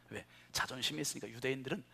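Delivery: ordinary talk, nothing marked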